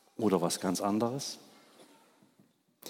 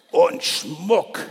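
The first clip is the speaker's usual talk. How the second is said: higher, louder squawk; croaking magpie; 6 semitones higher, 6 dB louder than usual. Acted. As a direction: screech it like a greedy magpie. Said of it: "krächzte die Elster". (Die Elster krächzt gierig nach Schmuck)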